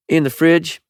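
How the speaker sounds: high clerk's voice, short reply; shop woman's voice. A narrator's reading. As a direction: point her to the fridge. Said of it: "the woman said".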